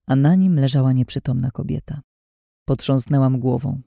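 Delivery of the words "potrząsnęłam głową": usual speaking voice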